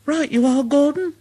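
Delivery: high little engine voice, cheerful; Thomas agreeing. Acted. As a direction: chirp a happy yes.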